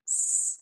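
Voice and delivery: long whistling hiss on س; held sibilant. Shown as a demonstration. Teacher saying the sound must linger, long hissed س. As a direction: sustain س alone for a while. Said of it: "یہ والی sound تھوڑی دیر رہنی چاہیے".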